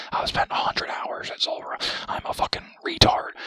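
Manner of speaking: loud, mocking rant as a sweaty pro gamer; mocking pro player rant. Gamer mocking Zulrah pros, loud mock rage. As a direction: ham up an outraged pro player. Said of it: "They're all".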